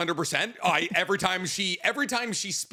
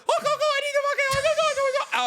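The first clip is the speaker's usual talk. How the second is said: much higher, hammed-up mumbled accent; mock Indian-Scottish accent; faster than usual. Mocking the pink-haired character nobody can understand, high garbled accent. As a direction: garble it fast in a mixed Indian-Scottish lilt. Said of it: "sounds like a mix of, like, Indian and Scottish".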